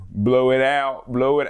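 badly faked Delco accent; attempted Delco accent. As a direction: have an amateur go at Delco. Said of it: "I can't do a Delco accent".

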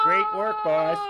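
mock crying. Sobbing cry in skit.

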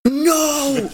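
high, loud shocked No; shocked shriek. Real, not acted. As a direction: squeal it out in shock. Excited reaction to found mug.

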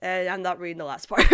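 chuckling while skipping the last part; laughing. Real, not acted. Vlogger laughing as she skips part of a viewer's comment. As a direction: laugh it off mid-line.

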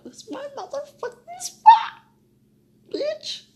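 slow, loud, high-pitched protest; high-pitched outburst. Real, not acted. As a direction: squeal it out in outrage. Protesting the stolen spot.

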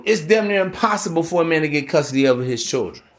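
ordinary talk, nothing marked

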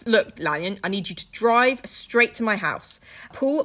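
ordinary delivery

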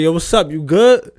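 loud, high, challenging greeting; pointed check-in. Shown as a demonstration. Host shows a different feel of you good, pointed check-in. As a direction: push it up into a loaded question. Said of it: "that you good got a different feel".

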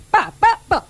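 short, high "pa" bursts; imitated fart pops. Teasing a colleague about farts every five minutes, imitated popping noises. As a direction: pop out mock farts, high and separated.

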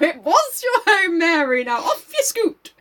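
high, slow, hammed-up solemn voice; drawn-out mock farewell, then shooing; mock solemn man. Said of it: "I believe it's a man".

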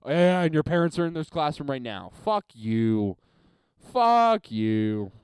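loud scornful comeback; loud retort. Retold or imitated. Retelling a classroom comeback to a classmate, loud scornful retort. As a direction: slam her with the comeback. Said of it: "And I'm like".

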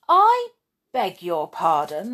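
affronted mother bear; indignant. Acted. Mother bear offended by Thumper, loud indignant start.

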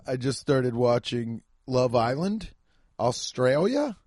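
ordinary delivery

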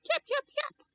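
very high tiny yips; cartoon puppy chirping; squeaky puppy voice. A narrator's reading. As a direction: yip like an excited little puppy. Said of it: "And Gizmo went".

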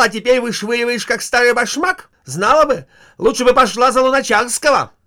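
very loud, high furious tirade; wife lashes out at husband, very loud and high; shrieking wife. Acted. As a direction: scream every reproach at him. Wife's furious jealous tirade.

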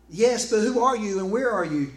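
loud, high questioning shout; calling up. Acted. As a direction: holler up to someone unseen. Jack calls up to unseen voice.

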